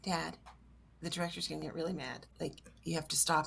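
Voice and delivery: soft, low urgent aside; acted under-the-breath caution; hushed warning. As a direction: warn dad discreetly on set. Quiet warning to dad on set.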